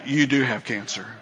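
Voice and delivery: low, soft, hinting at a sly Satan; Satan's voice. Retold or imitated. Satan taunting a fearful believer, softly low. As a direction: murmur it like a tempter. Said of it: "And Satan will say".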